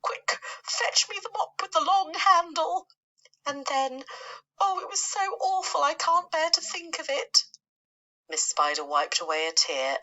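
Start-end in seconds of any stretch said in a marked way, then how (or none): 0.0-2.9 disgusted shout. Retold or imitated. Aunt Sponge orders the mop. 3.5-7.5 a fussy lady spider declaring her dislike; Miss Spider voice. Acted. Miss Spider recoils at memory.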